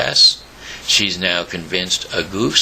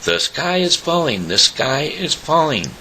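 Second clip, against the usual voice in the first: panicked screaming; 7 semitones higher than usual. Acted. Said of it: "panic and screaming".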